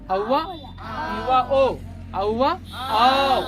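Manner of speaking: औवा, आओ stretched slowly and loudly; long drawn vowel. Shown as a demonstration. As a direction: stretch आओ out long like a sung note. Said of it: "देर तक एकदम राग मिलाना है". (आओ को देर तक खींचकर बोलिए)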